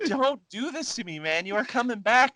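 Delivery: high, strained disbelief; exasperated outburst. Retold or imitated. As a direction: shout at a hero letting you down. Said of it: "And I'm like".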